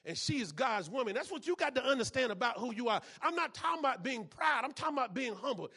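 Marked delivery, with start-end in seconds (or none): none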